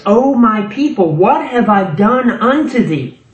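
high, insistent preacher voicing God; aggrieved, ringing declamation as God; God's impassioned appeal. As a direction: plead God's case to a wayward people. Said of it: "So what God is saying in verse three is".

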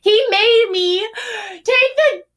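high, slow outraged voice; indignant squeal. Real, not acted. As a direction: rise up in outrage.

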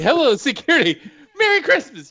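high, bright mock cheer on Merry Christmas; imitating the delivery. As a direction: toss it off cheerfully. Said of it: "Just the way he said".

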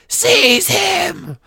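very high, raspy screamed order; villain shrieking a command. A narrator's reading. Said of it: "Voldemort screamed".

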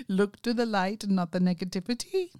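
imitated Indian accent, rising at the end. Repeating the caller's advice, Indian accent. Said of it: "he has a very strong Indian accent".

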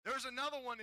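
ordinary talk

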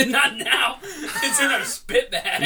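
high, laughing through words. Real, not acted. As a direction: laugh out the answer. Laughing hard at spit bag.

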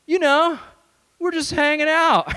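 high, loud, mock-casual dodge; chickening out. Chickens out before the surfer.

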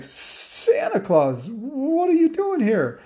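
high-pitched disbelief, drawn out at first; astonished. Acted. Zach opens the door on Santa, astonished. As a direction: gape in amazement, voice shooting up.

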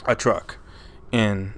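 usual speaking voice

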